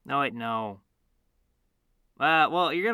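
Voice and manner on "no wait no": normal talking voice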